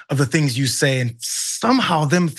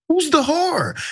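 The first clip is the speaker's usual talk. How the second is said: indignant; 12 semitones higher, slower than usual. Real, not acted. Indignant rhetorical question.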